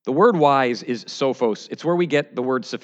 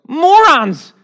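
much higher, loud exasperated shout; booming mock-divine rebuke, shouted; God shouting; 14 semitones higher, 10 dB louder than usual. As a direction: yell down at them like an outraged God. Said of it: "And God goes".

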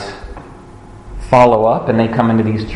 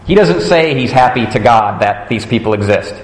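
ordinary talk

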